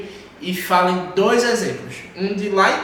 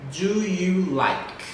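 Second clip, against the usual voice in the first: very slow, stretched "Do you like?"; teacher's slow repeat-after-me delivery; slow model question; much slower than usual. Shown as a demonstration. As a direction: draw out each word of "Do you like" for repeating.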